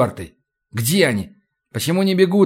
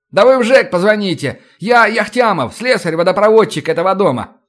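louder, higher, pushy insistence; insistent shout; 6 semitones higher, 4 dB louder than usual. Acted. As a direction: insist loudly through the door. Insisting at the door.

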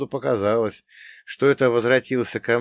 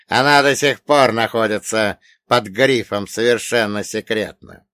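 громкий властный злодей; давит голосом, угрожая; злодей-олигарх; 2 semitones higher, 8 dB louder than usual. Acted. (громкий угрожающий олигарх)

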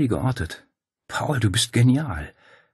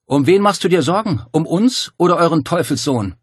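much higher, louder scornful shout; shouted taunt; 10 semitones higher, 7 dB louder than usual. A narrator's reading. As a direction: hurl the accusation across. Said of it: "rief dann zu Dario hinüber".

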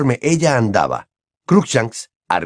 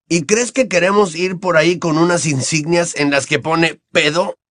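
put-on a lightly raised boyish voice; Ron's voice; 6 semitones higher than usual. A narrator's reading. Ron answers Hermione in a light boyish voice. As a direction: play the cheeky teenage boy.

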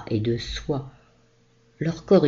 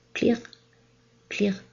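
glass clinking sound; 8 semitones higher than usual. A narrator's reading.

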